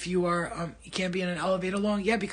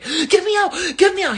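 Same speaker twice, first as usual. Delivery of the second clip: much higher, much louder crying shout to be let out; panicked screaming; 12 semitones higher, 11 dB louder than usual. Retold or imitated. Retelling the MRI panic to viewers, crying scream. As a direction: scream in terror, half sobbing. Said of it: "I was like".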